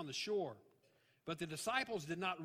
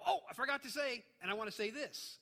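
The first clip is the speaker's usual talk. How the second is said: higher, louder burst of remembering; sudden afterthought; 7 semitones higher, 3 dB louder than usual. Acted. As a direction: blurt it out as if just remembered. Acted afterthought of a letter writer.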